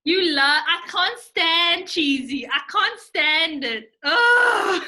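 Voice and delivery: loud, high exasperated outburst; passionate rant. Real, not acted. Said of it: "passionate, [name]".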